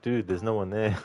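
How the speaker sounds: ordinary talk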